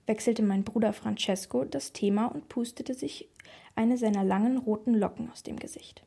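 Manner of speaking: plain reading voice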